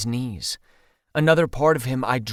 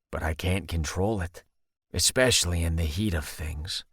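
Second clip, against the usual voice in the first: put-on sheepish young man, deeper voice; low, slightly embarrassed guy; lower male voice; 9 semitones lower, 5 dB quieter than usual. Seamus voiced by narrator.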